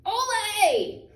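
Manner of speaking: high, loud triumphant rooster cry of Ole; a proud rooster's festive shout; rooster cheering. A narrator's reading. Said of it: "and the rooster said".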